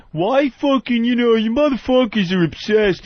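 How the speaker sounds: high, mocking whine; mocking whiny critic.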